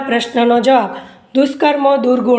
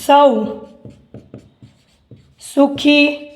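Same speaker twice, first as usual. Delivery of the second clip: word by word with long pauses; slow dictation; slower than usual. Acted. Teacher dictating an answer to students, slow dictation. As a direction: dictate slowly, leaving time to write.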